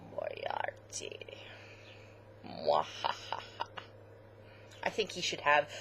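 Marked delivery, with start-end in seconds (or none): 0.1-1.2 low, breathy villain whisper; sinister whisper. Pretend. Ominous villain name whisper. 2.5-3.4 low mock villain cackle; evil laugh. Villain laugh after Moriarty.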